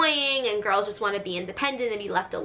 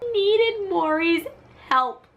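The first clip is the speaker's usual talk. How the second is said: high, loud, drawn-out cry; hammed-up high-pitched plea; dramatic mock plea; 9 semitones higher, 4 dB louder, slower than usual. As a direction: wail it slowly for comic effect. Dramatic repeat of mother's plea.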